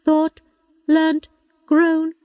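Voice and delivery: high, slow, even machine voice; computer voice. A narrator's reading. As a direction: speak like a patient old robot. Robot computer speaks.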